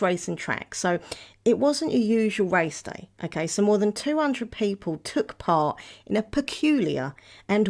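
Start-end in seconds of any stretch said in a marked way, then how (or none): none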